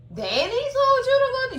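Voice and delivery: high, loud, stunned question; can't believe what she just heard; shocked disbelief. Retold or imitated. Karen hearing Danny sent him, high and loud in shock.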